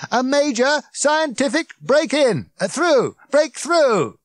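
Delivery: loud, high shouted announcement; blaring proclamation to a crowd; megaphone bark. A narrator's reading. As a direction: bellow on through the stumble. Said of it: "barked the megaphone".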